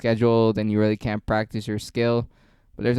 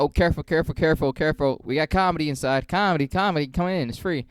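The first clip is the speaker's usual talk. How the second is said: higher, playful door-barker call; club barker; 5 semitones higher than usual. Retold or imitated. Comic barking outside a club to lure passersby, higher playful call. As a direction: lure passersby in with a sing-song pitch. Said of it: "this trick every single time I'm barking".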